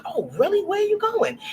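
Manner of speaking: a mock-amazed man asking where; surprised passenger.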